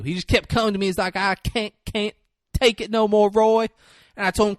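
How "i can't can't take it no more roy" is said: higher, acted halting plea; stuttering plea. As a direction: imitate a stammerer giving up. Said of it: "if you have a stuttering problem".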